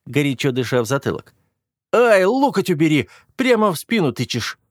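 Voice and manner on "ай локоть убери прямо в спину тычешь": pained yelp. Acted. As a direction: yelp as an elbow jabs. Elbow jabbing his back.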